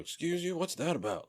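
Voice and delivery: mock offended. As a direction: play someone taking prim offense. Imitates someone taking offense.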